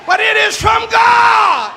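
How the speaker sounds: high, loud, drawn-out cry from the pulpit; preaching shout. Real, not acted. Preacher declaring it is from God, full-force shout. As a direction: shout it slowly, stretching GOD.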